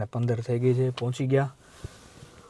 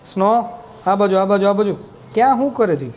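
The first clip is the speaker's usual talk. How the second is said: loud, higher call across the yard; calling the dog; 6 semitones higher, 6 dB louder than usual. Real, not acted. Owner calling his dog Snow over, loud and eager.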